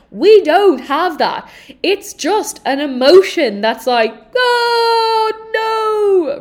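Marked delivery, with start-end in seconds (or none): 4.4-6.4 high, loud cry of "oh no"; mock panic. Coach voicing the emotion's panic to listeners, loud high mock cry. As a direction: wail it like sudden dread washing in.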